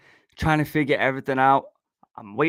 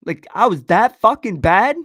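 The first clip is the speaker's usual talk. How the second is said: louder, higher, incredulous; indignant outburst; 6 semitones higher, 7 dB louder than usual. Real, not acted. Venting about ex who stayed with him, loud indignant disbelief. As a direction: throw the question out in disbelief.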